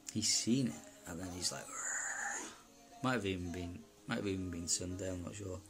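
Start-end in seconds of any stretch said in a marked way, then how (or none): 1.7-3.0 a breathy growled rrrr like a beast; imitated dragon roar. Retelling the demon's roar to his listener, mock growl. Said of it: "he was literally roaring".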